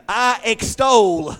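slow and loud, EXTOL stretched with a laugh; drawn-out extol. Shown as a demonstration. Preacher correcting the congregation, slow laughing emphasis. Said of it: "No, no".

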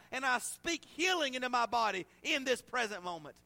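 loud, high preaching cry, pressing hard; fiery preaching. Acted. Preacher urging the congregation to pray now, fiery preaching. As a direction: preach it with full force, urgent.

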